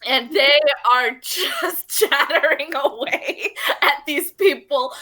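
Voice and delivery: ordinary talk, nothing marked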